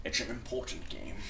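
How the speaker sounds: mild Scottish Sean Connery voice; lightly done Sean Connery accent; Sean Connery impression. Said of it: "that was kinda like Sean Connery".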